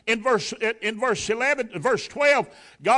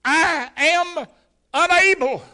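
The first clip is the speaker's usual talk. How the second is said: high, loud, strained protest; spluttering Moses; 9 semitones higher, 11 dB louder, slower than usual. Retold or imitated. Moses begging off before the Lord, loud spluttering protest. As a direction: force it out like a stammerer. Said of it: "I can't even speak plain for spluttering".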